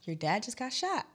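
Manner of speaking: imitated hushed bad news; friend breaking bad news. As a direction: break it to them gently, voice small. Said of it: "turns to me and she said".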